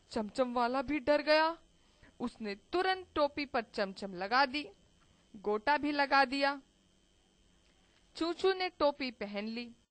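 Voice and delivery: ordinary delivery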